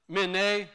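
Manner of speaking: "mene" ending in an ay sound; pronunciation demo. Shown as a demonstration. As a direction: say "mene" as min-AY. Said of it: "in this word".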